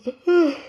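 big open-mouthed yawn. Real, not acted. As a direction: let out a loud yawn. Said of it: "why am I yawning?".

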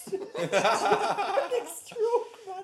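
high, very slow laughing squeal. Real, not acted. Laughs at his own stumbling.

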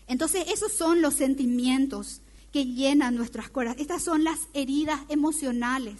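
ordinary talk, nothing marked

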